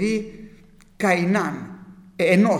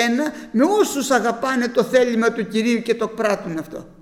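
loud, higher, impassioned sermon voice with a laugh in it; preaching at full force; 5 semitones higher, 4 dB louder than usual. Acted. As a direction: preach it out to the flock, rising and insistent. (κήρυγμα με πάθος)

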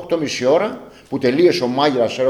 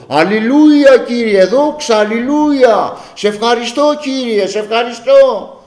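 raised mock-prayer voice, stretched endings; imitated praise shouting; 6 semitones higher, slower than usual. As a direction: mimic fervent cries of hallelujah and thanks. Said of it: "να φωνάζει".

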